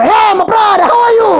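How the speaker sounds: very high, loud greeting; loud gym bro hello. Retold or imitated. Third gym regular greeting him, high and loud. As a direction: shout hello like a pumped gym guy.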